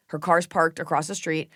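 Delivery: ordinary talk, nothing marked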